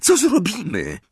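high, anxious squeak; high-pitched worried question. A narrator's reading. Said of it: "pisnęła Hermiona".

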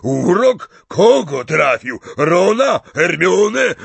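loud, high rapid-fire questions; big voice rising in alarm; alarmed shouting. Acted. Hagrid asks Harry who was cursed, shouting in alarm.

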